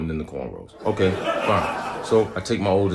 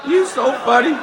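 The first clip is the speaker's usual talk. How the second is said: mocking wife. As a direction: laugh it out high like the wife. Wife mock-laughing at him.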